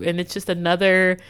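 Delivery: normal talking voice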